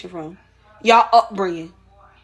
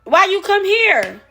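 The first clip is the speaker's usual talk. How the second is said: incredulous mockery; 3 semitones higher than usual. Real, not acted. Mocking rhetorical question to immigrants.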